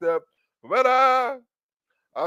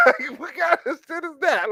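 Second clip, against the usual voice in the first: disbelief; 10 semitones higher than usual. Real, not acted. Comic reacting to spider sex, high shocked shout.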